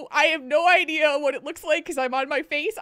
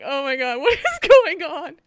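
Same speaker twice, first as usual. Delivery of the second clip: laughing; 2 semitones higher, 8 dB louder than usual. Retold or imitated. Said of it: "I'm just like".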